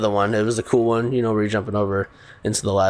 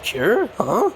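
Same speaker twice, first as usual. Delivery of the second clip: baffled question; 9 semitones higher than usual. Retold or imitated. Kevin baffled by word cure.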